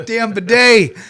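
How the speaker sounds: high, loud comic outburst; mock curse. Closing the flood story, loud mock curse. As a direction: cry it out at the hated fixture.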